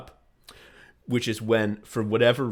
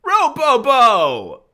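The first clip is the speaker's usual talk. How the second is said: very high, drawn-out shout; over-the-top slow yell; gleeful catchphrase; 11 dB louder, much slower than usual. Shown as a demonstration. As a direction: belt the silly sound out slowly. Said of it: "I need to say this sound".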